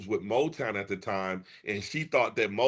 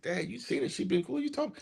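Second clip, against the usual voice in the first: incredulous son; 10 semitones higher, 7 dB quieter than usual. Retold or imitated. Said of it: "he said".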